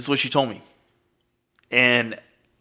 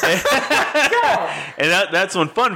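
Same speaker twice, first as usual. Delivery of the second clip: laughing; 7 semitones higher, much slower than usual. Real, not acted. Laughing with co-host.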